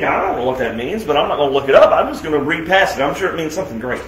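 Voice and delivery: low, quick, dismissive inner voice; mock careless reader. Preacher mimicking churchgoers skimming scripture, flippant and fast. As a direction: rattle it off like you can't be bothered. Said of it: "you think".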